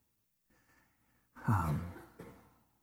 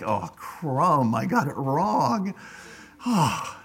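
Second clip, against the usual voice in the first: dismayed realization; much slower than usual. Retold or imitated. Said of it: "then you realize you've got it wrong".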